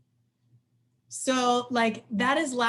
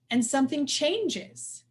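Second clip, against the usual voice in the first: normal talking voice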